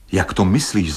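ordinary delivery

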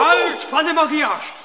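high, drawn-out call to halt; stern, stretched-out "halt"; commanding interruption. Acted. Doctor halts the cursing patient.

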